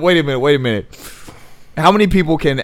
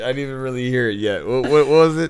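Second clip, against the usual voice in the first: ordinary talk, nothing marked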